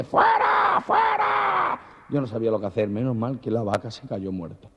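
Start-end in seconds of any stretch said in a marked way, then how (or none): 0.0-1.8 very high, drawn-out shout; mock crowd chant from the stands; jeering crowd. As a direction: yell it like an angry mob. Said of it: "la gente".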